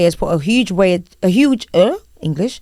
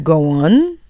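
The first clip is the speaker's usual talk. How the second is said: normal talking voice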